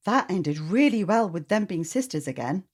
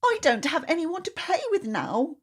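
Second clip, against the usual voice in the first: crying out; 11 semitones higher than usual. Acted. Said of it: "cried Duha".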